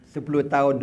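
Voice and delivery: ordinary delivery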